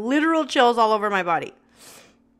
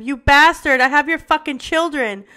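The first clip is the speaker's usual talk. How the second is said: loud, higher furious scream; 4 semitones higher, 6 dB louder than usual. A narrator's reading. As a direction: scream down the phone at him. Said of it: "I screamed at him".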